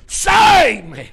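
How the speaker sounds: high, loud shouted plea; preacher acting a desperate shout; crying out. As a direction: cry out to be saved. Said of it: "he cried out".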